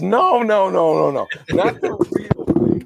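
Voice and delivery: getting carried away retelling the skit; overexcited. Retold or imitated. Retelling the skit to the co-host, overexcited and breathy. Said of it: "I'm getting overexcited".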